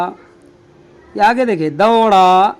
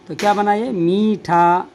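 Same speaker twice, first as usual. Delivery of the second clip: normal talking voice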